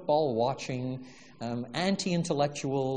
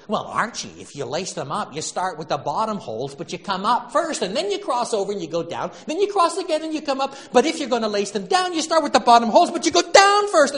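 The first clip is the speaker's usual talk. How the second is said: rising higher and louder, whiny and insistent; whiny wife explaining; 8 semitones higher, 9 dB louder than usual. Retold or imitated. Wife explaining lacing to Archie, whining higher and louder. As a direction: lecture him in a climbing whine. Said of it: "She says".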